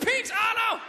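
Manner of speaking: sudden high, loud cry; imitated neck snap. Air drummer's neck gives out, mock pained shout. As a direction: yelp it out in alarm. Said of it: "mon cou, il a fait".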